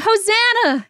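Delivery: high mock cheer; crowd yelling. A narrator's reading. Crowd cheering the man, high joyful mock yell. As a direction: call it out like a crowd.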